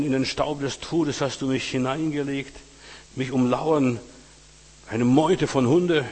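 a preacher voicing the psalmist's anguish; impassioned lament reading.

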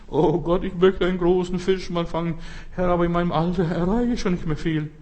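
lightly voiced wishful believer; Ananias. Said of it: "So wie dieser Bruder Ananias".

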